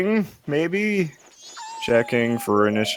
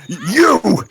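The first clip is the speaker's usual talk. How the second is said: stuttering accusing shout; 8 semitones higher, 6 dB louder than usual. Acted. Balrog recognizing enemy.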